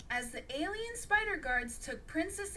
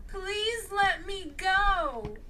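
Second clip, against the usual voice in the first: slow, high, louder plea; pleading; 7 semitones higher, 6 dB louder, much slower than usual. A narrator's reading. Captive princess begging the spider guard, pleading. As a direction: drag out the begging. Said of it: "Princess A pleaded".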